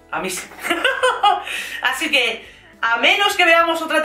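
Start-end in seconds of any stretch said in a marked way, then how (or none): 0.6-1.8 high, bubbling giggle; real delighted chuckle; amused laugh. Real, not acted. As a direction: laugh at your own surprise news. Laughs after her big announcement.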